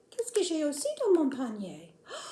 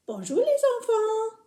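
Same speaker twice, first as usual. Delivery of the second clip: high puppet greeting; 8 semitones higher, 6 dB louder than usual. Acted.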